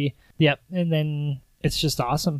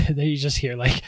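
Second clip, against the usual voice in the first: ordinary talk, nothing marked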